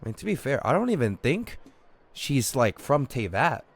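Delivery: ordinary talk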